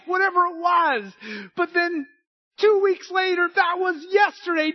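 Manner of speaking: high, loud whiny rant, hammed up; mock complainer. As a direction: play the fickle believer shouting that God left him. Mocking a complaining believer.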